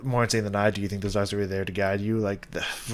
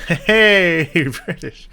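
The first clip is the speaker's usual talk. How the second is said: loud, high, laughing "hey"; delighted cheer; 8 semitones higher, 9 dB louder, much slower than usual. Real, not acted. Reviewer learning the slang is Southern UK, delighted cheer.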